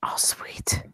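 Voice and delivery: whispered little cheer; soft, unvoiced reaction of delight; hushed delight. Real, not acted. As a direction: whisper it, pleased.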